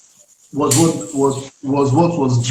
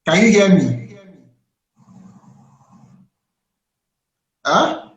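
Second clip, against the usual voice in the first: to someone hard of hearing; 4 semitones higher, 4 dB louder than usual. Real, not acted. Bad call line, asking louder.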